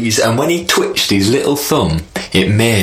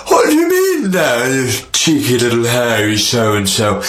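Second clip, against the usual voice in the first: sky king. Acted. Sky king scolds spider.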